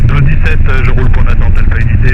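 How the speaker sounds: radio-filtered headset voice; over a pilot headset. Real, not acted. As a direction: talk like over an aviation radio.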